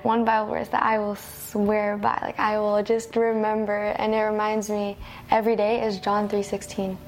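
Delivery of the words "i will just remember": ordinary delivery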